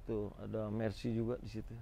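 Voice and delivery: ordinary talk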